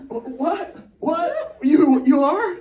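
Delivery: mock astonished disbelief. Friends hearing he's joining them in the Uber, astonished. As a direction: act out friends reacting to big news, high-pitched and incredulous. Friends' tearful surprise retold.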